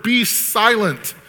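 high, slow, drawn-out order; powerful command. Acted. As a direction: stretch it into a commanding word. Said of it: "that kind of powerful word".

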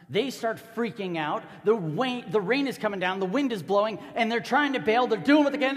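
loud, high storm narration rising toward frenzy; frantic, escalating storytelling; building panic. Acted.

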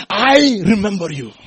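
high, ringing declaration; loud proclamation. Acted. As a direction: cry it out to the congregation. Jesus's words proclaimed to congregation.

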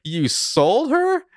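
very high, drawn-out mock outrage; shocked disbelief. Spencer learning the baby was sold, mock shock. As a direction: stretch it out in hammed-up horror. Acted shocked reaction to reveal.

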